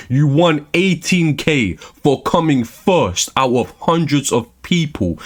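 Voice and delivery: normal talking voice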